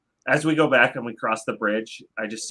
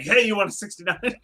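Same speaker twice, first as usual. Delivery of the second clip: higher-pitched, garbled eager offer; hasty stumbling blurt; 7 semitones higher than usual. Acted. Impatient adventurer to hooded stranger, stumbling blurt. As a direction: rush it so words get lost. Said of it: "I say it so fast, I stumble over the words".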